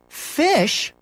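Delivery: high, surprised question; mother taken aback. A narrator's reading. Mother surprised at son's purchase. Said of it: "Missus Huggins sounded surprised".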